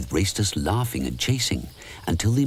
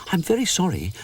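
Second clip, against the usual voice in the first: polite; 11 semitones higher than usual. A narrator's reading. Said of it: "Rupert says politely".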